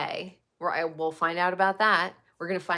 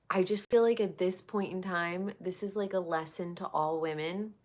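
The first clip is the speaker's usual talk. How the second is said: usual speaking voice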